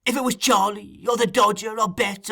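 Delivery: high-pitched wheedling list. A narrator's reading. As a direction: try each name in a high voice.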